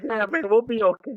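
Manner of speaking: high, giggly mock-tearful voice; tearful laughing. Soldier retelling his reply to his buddy, tearful laughing. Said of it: "tearing up, but we were laughing".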